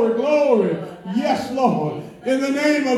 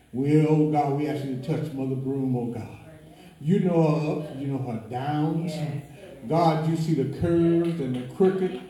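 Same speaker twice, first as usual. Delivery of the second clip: low, soft, earnest prayer; hushed prayer; 8 semitones lower, 5 dB quieter than usual. Real, not acted. Praying to God for Mother Broom, low hushed prayer. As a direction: pray quietly and low over someone.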